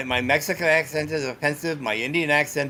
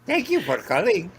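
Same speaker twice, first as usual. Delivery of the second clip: higher-pitched Pakistani accent; 6 semitones higher than usual. Shown as a demonstration. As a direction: put on a Pakistani tech-support greeting. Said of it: "work on my Pakistani accent".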